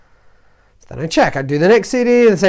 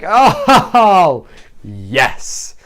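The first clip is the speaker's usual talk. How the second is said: re-enacted triumph; 4 dB louder than usual. Reliving CD one finally uploading, re-enacted triumph.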